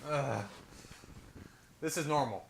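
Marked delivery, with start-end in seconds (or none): 0.1-0.4 eye-rolling "ugh"; short put-on groan of disdain; exasperated groan. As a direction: groan as if rolling your eyes. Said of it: "We roll our eyes".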